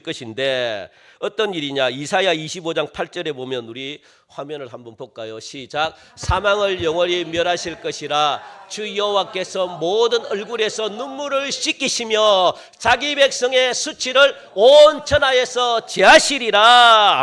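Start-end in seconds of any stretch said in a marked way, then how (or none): 6.2-17.2 loud, slow, rising to a shout; full-force scripture reading. Real, not acted. Preacher leading the congregation through Isaiah, full-force scripture reading. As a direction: proclaim the verse, stretching key words.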